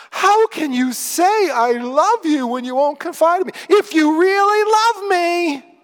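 high, loud, drawn-out mock whine; guilt-tripping whine. Preacher acting out Delilah guilt-tripping her man, high whiny mock plea. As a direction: whine it loudly like a hammed-up guilt trip. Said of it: "And that kind of "ee" in the voice".

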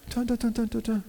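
ominous dun dun. Acted. Dramatic sound effect.

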